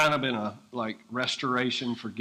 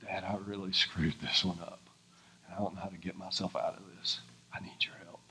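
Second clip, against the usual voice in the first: hushed prayer trailing into a whisper; humble plea to God; 7 semitones lower, 9 dB quieter than usual. Retold or imitated. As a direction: lower and softer, fading to a whisper. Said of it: "be like".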